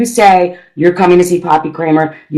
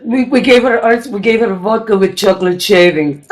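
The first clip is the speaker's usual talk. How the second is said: put-on Russian-accented confession for laughs; comic Russian accent; mock Russian accent; 3 semitones higher than usual. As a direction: play a guilty Russian neighbor. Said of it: "Russian dog version of Flowers".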